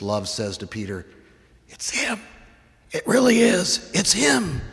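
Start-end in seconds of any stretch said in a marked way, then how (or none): 1.7-4.6 excited recognition. Acted. Disciple recognizes Jesus.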